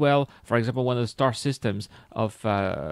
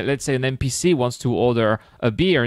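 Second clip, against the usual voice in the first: normal talking voice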